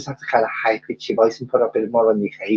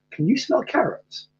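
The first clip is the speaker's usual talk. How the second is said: high, soft snowman voice; joke character voice; 13 semitones higher, 4 dB quieter than usual. Acted. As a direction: raise the pitch for the snowman's question. Telling joke, voicing a snowman.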